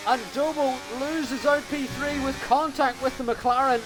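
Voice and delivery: rising to a high, fast call; excited commentary. Real, not acted. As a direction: build the call as contact happens. Overtake and contact, rising excitement.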